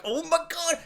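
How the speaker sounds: very high, hushed squeal; on-edge gasp. Retold or imitated. Viewer on the edge of their seat, high hushed squeal.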